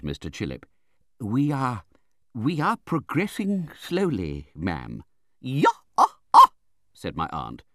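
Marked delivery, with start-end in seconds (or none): 1.2-5.0 high, slow, hesitant doctor, falling at the end; Mister Chillip. A narrator's reading. Doctor gives the aunt slow news, meek. 5.4-6.5 high, loud, explosive snarled bursts. A narrator's reading. Aunt snaps at the doctor's report, snarling. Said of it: "with such a snarl".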